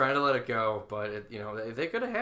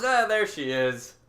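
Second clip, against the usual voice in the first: mock delight; 6 semitones higher, 7 dB louder, much slower than usual. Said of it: "imitating Gene spotting twitch".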